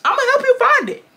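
high-pitched, taunting offer; sing-song threat; mock-sweet taunt. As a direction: taunt it up high, like coaxing a child. Acted taunt in the retold quarrel.

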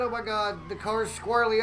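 ordinary talk, nothing marked

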